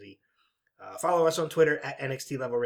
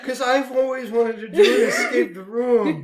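laughing; 11 semitones higher, 10 dB louder than usual. Real, not acted. Begging for free entry, laughing.

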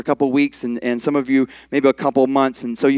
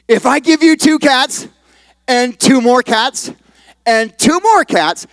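slow, raised teacher voice with pauses; careful, spelled-out question; 8 semitones higher, slower than usual. Acted. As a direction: lay out each part slowly for a child. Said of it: "Listen carefully".

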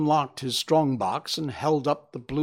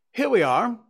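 cheerful announcement, higher and louder; cheery; 10 semitones higher, 6 dB louder than usual. A narrator's reading. Holmes bringing his guest into the room, cheerily. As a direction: usher them in cheerily, pitched up. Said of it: "said Holmes cheerily as we filed".

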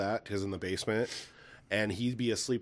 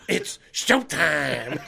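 very slow, louder showman's call; Beetlejuice catchphrase; 3 semitones higher, 5 dB louder, much slower than usual. Retold or imitated. Dad demonstrating Beetlejuice properly, drawn-out theatrical call. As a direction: stretch out both words with relish. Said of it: "You gotta go".

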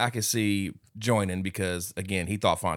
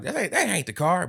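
high, anxious denial; nervous denial; 10 semitones higher than usual. Retold or imitated. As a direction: insist it's not the car. Slick denying.

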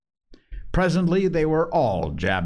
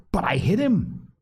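insistent; 12 semitones higher than usual. Acted. Boy insisting against rival.

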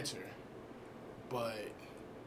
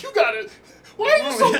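squeaky falsetto whine, much higher and louder; mock inner voice; 13 dB louder, much faster than usual. Mimicking her harsh inner voice, high falsetto whine. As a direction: squeal it like a hurt little voice. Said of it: "and I'm like".

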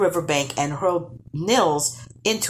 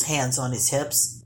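usual speaking voice

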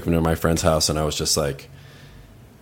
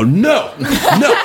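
laughing refusal; 4 dB louder than usual. Retold or imitated.

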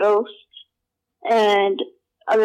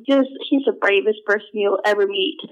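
usual speaking voice

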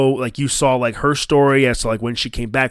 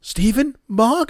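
rising, higher questioning names; confused calling; 8 semitones higher than usual. Retold or imitated. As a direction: call out unsure who's there. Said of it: "It's like".

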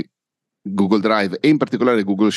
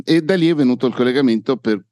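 ordinary delivery

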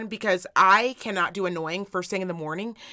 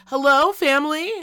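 raised, louder singsong call; 6 semitones higher, 5 dB louder than usual. Acted. Mom waking up calls to her family, calling out. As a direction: call out higher to the household.

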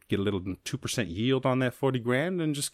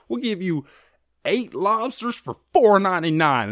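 high, bright hammed-up ad announcer; ad pitch voice; 11 semitones higher, 7 dB louder than usual. Red Lobster pitching a cheap deal, hammed-up high announcer voice. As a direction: sell the deal like a cheesy commercial. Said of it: "Red Lobster was like".

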